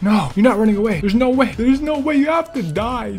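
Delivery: usual speaking voice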